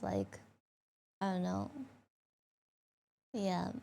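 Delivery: ordinary talk, nothing marked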